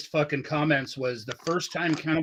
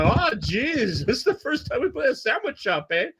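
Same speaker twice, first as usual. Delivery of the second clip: much higher Quebec French-Canadian impression; hammed-up Quebec singer, voice climbing; Quebec accent; 9 semitones higher than usual. Repeating the singer's sandwich shop line, exaggerated high Quebec accent. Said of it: "in that Quebec accent".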